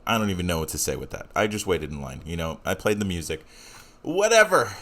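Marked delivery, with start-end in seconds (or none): 4.0-4.7 dismissive. Real, not acted. Host brushing off his rant.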